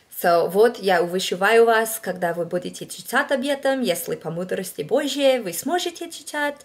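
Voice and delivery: out-of-practice learner reading scripture in Russian; rusty Russian reading. Shown as a demonstration. Reading a Russian passage aloud for viewers in her rusty Russian. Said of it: "this just isn't how it's supposed to sound".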